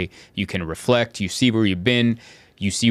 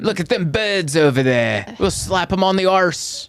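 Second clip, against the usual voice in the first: higher-pitched cheeky British guy; bad British accent; 8 semitones higher than usual. Host inventing a British guy's line, mock British accent. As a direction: ham up a British bloke. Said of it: "what's a British guy thing to say".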